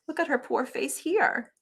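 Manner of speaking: ordinary delivery, nothing marked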